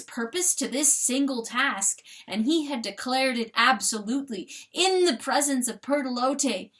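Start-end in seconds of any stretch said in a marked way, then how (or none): none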